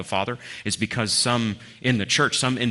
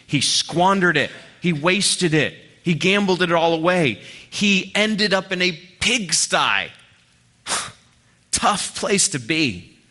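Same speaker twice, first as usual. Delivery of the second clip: raised, higher, louder preaching voice; fervent preaching; 7 semitones higher, 4 dB louder than usual. Real, not acted. Preacher driving home the prodigal son's fall. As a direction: build the story with full force.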